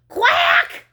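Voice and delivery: loud, high outraged quack; enraged duck cry. A narrator's reading. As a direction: quack it out in a rage. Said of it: "she cried".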